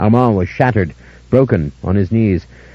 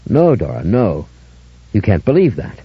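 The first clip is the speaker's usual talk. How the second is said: ordinary delivery, nothing marked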